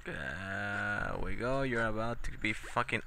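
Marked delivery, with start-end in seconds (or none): 0.0-1.6 drawn out. Real, not acted. Gamer to himself after catching the pig, slow drawn-out satisfaction. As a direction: stretch there we go very slowly, go rising. Gamer savoring a small win.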